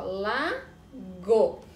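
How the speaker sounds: "lago" split slowly into la-go; teacher modelling "lago" slowly in syllables. Shown as a demonstration. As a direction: spell it out in slow syllables. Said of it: "separou as sílabas".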